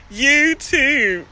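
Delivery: "You too" with drawn Baltimore vowels; Baltimore accent. Shown as a demonstration. Streamer teased by viewers, showing her Baltimore accent on "You too". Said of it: "Y'all love my Baltimore accent".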